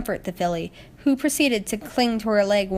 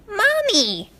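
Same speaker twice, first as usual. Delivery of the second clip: high, bright little-filly call of Mommy; 13 semitones higher, 3 dB louder than usual. A narrator's reading. Little filly calling to Spitfire, high childish call. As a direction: call out like a small child spotting her mother.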